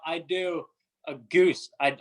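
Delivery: ordinary talk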